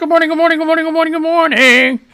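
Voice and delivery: high, sing-song DJ hello to the listeners; radio DJ greeting. Acted. Radio host greeting his online audience, sing-song and high. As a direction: boom it out like a morning radio host.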